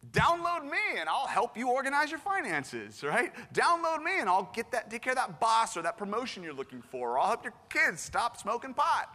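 mock sales pitch. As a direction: sell it like a too-good app promo. Parodies Jesus as an app pitch.

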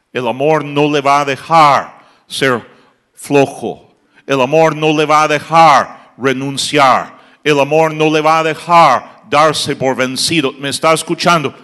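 rally the pastors, voice at full force; fiery preacher urging on; preaching at full force. Acted. Preacher's repeated exhortation.

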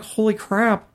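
ordinary talk

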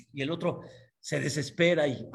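normal talking voice

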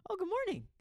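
high, sing-song good morning; mock her chirpy morning hello; mimicked high greeting. Teasing his friend with a high chirpy good morning.

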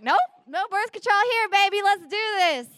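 high, loud, gung-ho reply; eager go-ahead. Retold or imitated. Answering lion man's birth control question, gung-ho. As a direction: urge him on high and loud.

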